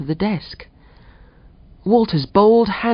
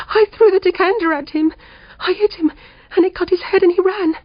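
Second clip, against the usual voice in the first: much higher, tearful and half laughing; a frightened woman near hysterics after the burglar; shaky hysteria; 12 semitones higher than usual. A narrator's reading. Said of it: "tearful and laughing with shaky hysteria".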